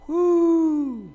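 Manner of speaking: high, loud whoop of delight; a celebratory whoop; whoop of joy. Real, not acted. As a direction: let out a joyful whoo. Celebrating awards package.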